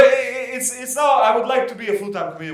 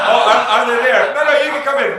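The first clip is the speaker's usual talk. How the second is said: loud, high call to latecomers; raised voice, inviting people in; 6 semitones higher, 10 dB louder, much faster than usual. Real, not acted. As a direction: call them in from the stage. Calls to audience members returning.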